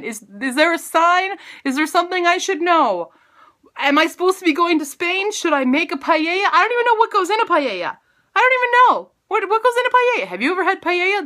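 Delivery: high-pitched comic panic; hammed-up frantic questioning; mock panic. Mock panic over paella haunting her.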